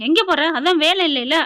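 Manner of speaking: mother-in-law scolds daughter-in-law, loud mock taunt; mock scolding. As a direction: snap at her like a nagging mother-in-law. Mother-in-law snaps at Mathi.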